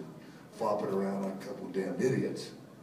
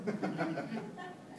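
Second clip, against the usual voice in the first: soft laugh; 10 semitones higher, 6 dB quieter than usual. Real, not acted. Laughs at the kids' retort.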